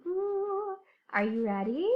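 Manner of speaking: usual speaking voice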